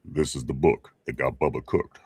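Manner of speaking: very low, deep announcer imitation; James Earl Jones. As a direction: drop right down for a deep-voiced ad tagline. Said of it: "with that real deep voice".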